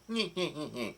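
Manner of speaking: soft nyeh-nyeh cackle; imitated villain snicker. Retelling Brulé boasting about mirrors, quiet mock villain cackle. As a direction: mimic a sly villain laugh under the voice.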